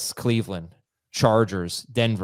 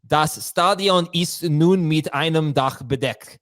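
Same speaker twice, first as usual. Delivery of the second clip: mock German; 2 semitones higher, 4 dB louder, slower than usual. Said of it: "I think what you're trying to say".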